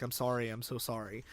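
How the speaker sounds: soft, low retold apology; hushed and low, gently acted regret; subdued apology. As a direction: quietly voice a grieving apology. Retells grieving hero's apology to wife.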